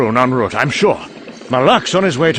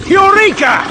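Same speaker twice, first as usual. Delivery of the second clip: triumphant cry, much higher and louder; excited eureka; 6 dB louder than usual. Acted. Doctor suddenly recalling his plan, a high triumphant shout.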